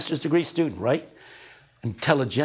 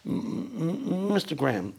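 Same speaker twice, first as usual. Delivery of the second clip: softer, stammered mock-timid address; imitating a frightened, stuttering sharecropper; John Perkins impression; 3 semitones higher, 6 dB quieter than usual. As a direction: barely get the name out. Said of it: "hardly can get out the name".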